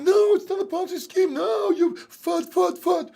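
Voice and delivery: high shouting mock of the believers; mocking crowd. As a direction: shout them down like the hyped crowd. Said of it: "And everyone was like".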